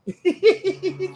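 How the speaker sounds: high-pitched real giggle; laugh. Real, not acted. Host amused by co-host's remark to the viewers, high laughing.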